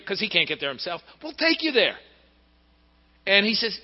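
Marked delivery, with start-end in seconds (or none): 1.2-2.0 high, eager friends coaxing him along; lightly voiced eager friends. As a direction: coax him like excited buddies. Friends urging the paralyzed man.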